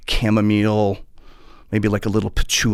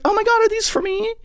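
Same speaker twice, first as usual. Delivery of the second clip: much higher, breathless delight; gushing Paula. Retold or imitated. Paula thanks the fan for flowers, high gushing delight. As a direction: gush like a thrilled pop star.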